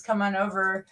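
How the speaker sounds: ordinary delivery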